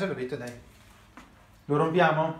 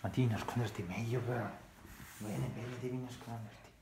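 soft, hushed advice; 4 semitones lower, 12 dB quieter than usual. Real, not acted. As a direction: keep it hushed so nobody hears. Quiet hide and seek advice.

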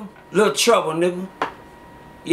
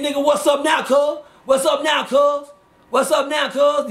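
gang taunt; 7 semitones higher than usual. Retold or imitated. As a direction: bark the challenge at him like a street rival. Retold gang challenge, shouted.

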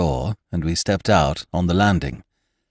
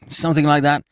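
plain reading voice

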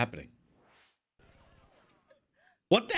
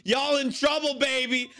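usual speaking voice

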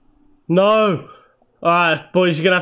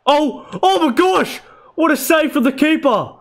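high cries of disbelief; amazed outcry; 9 semitones higher than usual. Real, not acted. Gamer seeing the keeper save his shot, amazed outcry. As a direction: exclaim at a stunning save.

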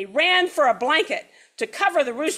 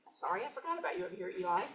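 quiet aside; 3 semitones higher, 13 dB quieter, much faster than usual. Real, not acted. Aside to a listener mid-story.

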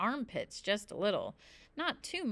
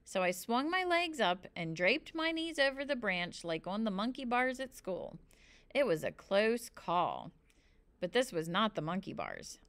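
plain reading voice

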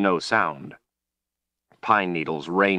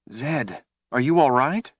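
higher-pitched, anxious question; concerned; 7 semitones higher than usual. A narrator's reading. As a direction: check on him with mild alarm. Worried woman checks on wizard.

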